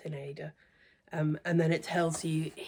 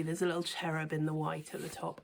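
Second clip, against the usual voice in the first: usual speaking voice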